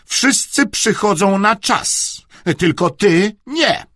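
slow, high, loud scolding; drawn-out pointed reproach; said through clenched teeth. A narrator's reading. Aunt scolding the late uncle, through clenched teeth. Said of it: "wycedziła".

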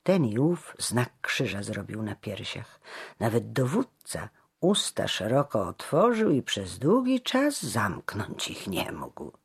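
ordinary delivery

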